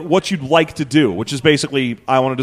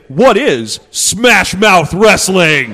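dramatic announcer; 4 semitones higher, 6 dB louder, much slower than usual. Retold or imitated. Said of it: "They say".

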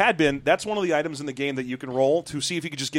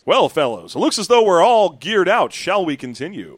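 booming announcer-style robot leader; announcer character voice; 3 semitones higher, 7 dB louder than usual. Acted. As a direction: announce it like a grand host. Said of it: "a little more announcery".

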